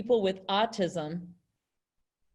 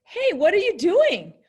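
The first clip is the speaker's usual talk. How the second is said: bright, much higher and louder greeting; happy; 9 semitones higher, 7 dB louder than usual. Shown as a demonstration. Teacher demoing a happy greeting to class. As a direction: sound delighted to see them. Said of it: "One of them is very happy".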